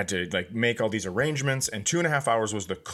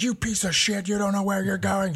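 high mock yell at the GPS; high-pitched frustrated shout at a phone; screaming at the phone; 10 semitones higher than usual. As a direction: curse out the maps app in a shrill retold rant. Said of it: "just, like, screaming at the phone".

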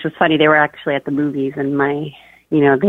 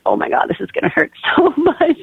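high-pitched, laughing mock dread; 12 semitones higher than usual. Mother retells bracing for labour pain, laughing through the dread. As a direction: let laughter break into the dreaded thought.

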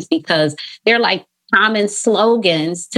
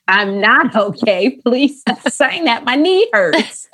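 put-on higher, slower voice of a hurt little kid; 5 semitones higher, slower than usual. Therapist voicing a hurt child who fell down the stairs, higher and slower. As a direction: plead like a small child who just fell.

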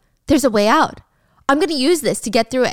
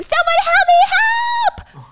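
very high, loud shriek; screaming for help; 5 dB louder, slower than usual. A narrator's reading. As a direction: scream out for rescue, stretching the last word. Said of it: "Gia just starts screaming".

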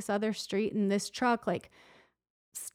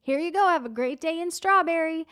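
put-on high, louder chirpy child; little boy voice; 8 semitones higher, 5 dB louder than usual. Boy wishing the visitor a great day, high chirpy voice. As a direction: chirp it like a friendly kid. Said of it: "the one boy goes".

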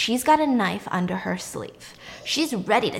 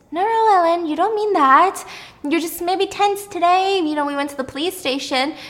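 high, protesting reassurance; placating friend; 7 semitones higher than usual. Acted. Hannah insists Ellen doesn't mean it, high placating voice. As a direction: soothe her in a raised, higher voice. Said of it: "Hannah says".

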